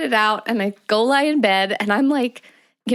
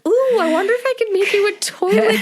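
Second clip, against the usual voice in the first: higher, delighted inventor's ooh; eager ooh; 10 semitones higher than usual. Retold or imitated. Wife imitates husband's excited toilet seatbelt idea, higher and eager. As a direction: sound thrilled by a new idea. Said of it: "He was like".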